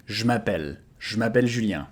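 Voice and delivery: "Je m'appelle" with "je" cut to a bare zh; contracted je. Shown as a demonstration. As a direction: run "je" into "m'appelle" with no E. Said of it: "most of the people will contract it to".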